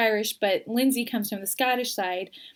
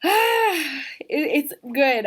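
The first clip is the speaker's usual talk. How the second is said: thanking viewers through tears, emotional and choked; holding back tears; 5 semitones higher, 4 dB louder, much slower than usual. Real, not acted. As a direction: voice catching, fighting back real tears. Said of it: "I'm trying not to cry".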